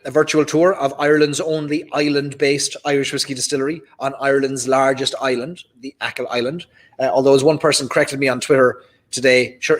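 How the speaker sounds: usual speaking voice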